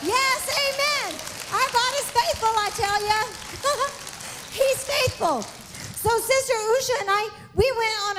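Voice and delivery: high, excited preaching with shouts of praise; preaching at full force. Real, not acted. Preacher rousing the church to praise, fervent. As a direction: lift the congregation with praise.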